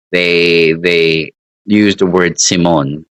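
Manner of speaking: ordinary talk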